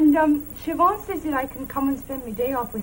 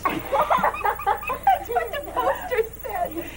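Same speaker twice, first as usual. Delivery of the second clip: higher-pitched, slow words through laughter; laughing; 11 semitones higher, much slower than usual. Acted. Woman telling family of the fat lady, laughing. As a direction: giggle helplessly at your own tale. Giggling over her own fat-lady tale.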